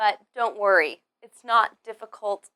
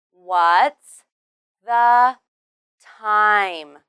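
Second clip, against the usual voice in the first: slow, louder, each word separated; 8 dB louder, much slower than usual. Shown as a demonstration. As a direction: isolate every word for learners. Word-by-word pronunciation demo for learners.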